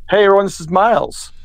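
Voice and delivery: ordinary talk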